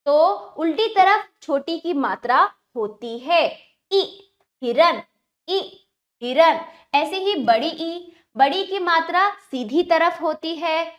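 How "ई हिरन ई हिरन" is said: slow "ई", then "हिरन" said separately; vowel drawn out. Shown as a demonstration. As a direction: sound out "ई", pause, then "हिरन". मात्रा की ध्वनि अलग करके दिखाना. ("ई" को खींचकर, फिर "हिरन")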